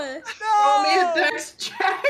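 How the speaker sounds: slow, high and laughing. Real, not acted. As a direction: ask again through laughter. Same words repeated laughing.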